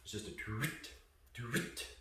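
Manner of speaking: a soft vocal "toot, toot"; toot sound. Shown as a demonstration. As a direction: breathe out a quiet "toot". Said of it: "here's what it sounds like".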